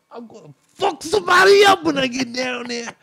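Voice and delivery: high, loud roaring threat; so mad. Retold or imitated. Uncle threatening the noisy room, loud roaring anger. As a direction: bellow a threat down the hallway. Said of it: "he's just so mad".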